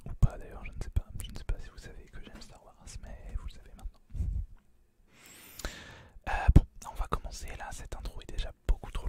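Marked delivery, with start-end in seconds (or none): none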